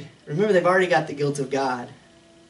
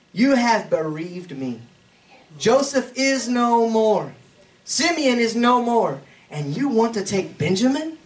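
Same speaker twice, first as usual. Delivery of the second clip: slow, raised reading of a father's grief; slow scripture lament; 8 semitones higher, 4 dB louder, slower than usual. Acted. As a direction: read Jacob's words heavy and slow. Reads Jacob's lament in scripture.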